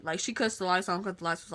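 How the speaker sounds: usual speaking voice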